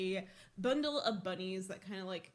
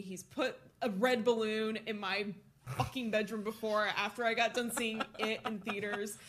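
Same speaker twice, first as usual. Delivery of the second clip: ordinary delivery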